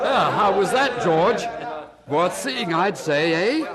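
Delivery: gleeful. Acted. Gleeful after watching the hanging.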